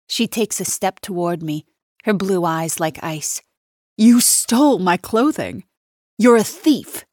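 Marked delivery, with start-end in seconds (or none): 4.0-7.0 higher and louder, hurling the charge; angry accusation. Acted. Nina accusing the maid of theft, loud and higher. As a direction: accuse her outright, voice climbing.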